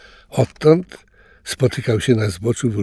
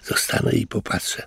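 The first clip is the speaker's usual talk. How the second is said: hushed, half-whispered musing to himself; curious thought; 7 dB quieter than usual. A narrator's reading. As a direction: think it quietly, full of curiosity. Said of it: "pomyślał zaciekawiony Louis". (ciche, ciekawskie rozmyślanie)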